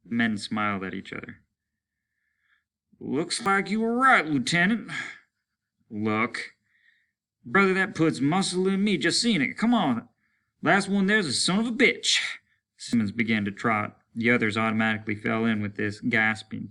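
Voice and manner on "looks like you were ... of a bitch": higher, louder eager banter; joyful soldier urging a race; elated. Acted. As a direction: egg the others on to race. Simmons elated at the dome.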